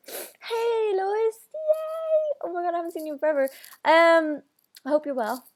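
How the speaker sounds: squealing high, delighted hello to a friend; light up and rattle it off fast; excited greeting. Real, not acted.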